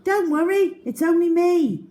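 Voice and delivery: calm her, it's only you; boy reassuring a scared girl; Algy reassuring. A narrator's reading. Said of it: "said Algy".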